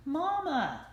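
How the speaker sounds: high call for mama; crying out. A narrator's reading. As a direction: call out for mother. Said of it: "the ducklings cried".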